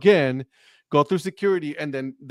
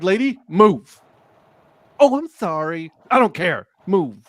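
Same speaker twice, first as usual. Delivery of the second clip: louder, impatient lady move; exasperated yell; 4 semitones higher, 5 dB louder than usual. Retold or imitated. Retelling yelling at the lady blocking the walkway, loudly. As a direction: push her aside with the voice.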